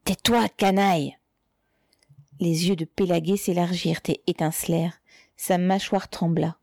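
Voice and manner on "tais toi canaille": spat-out order, only slightly raised; mild sharp cry. A narrator's reading. Spy shaking his fist in the mother's face, angry cry. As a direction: cry it angrily but contained. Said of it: "cria d'une voix aiguë".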